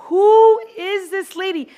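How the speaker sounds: high, loud and slow disbelief; baffled. Retold or imitated. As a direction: stretch it out, stunned by a stranger. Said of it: "And I'm like".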